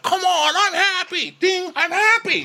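very high, hammed-up squeal; imitating a man wildly insisting; mock happy insistence. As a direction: shriek it in mock exasperation. Retold outburst with game dings.

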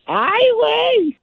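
high, slow drawn-out "Ay, güey"; put-on voice. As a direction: stretch it out in a silly high voice. Recurring put-on catchphrase voice.

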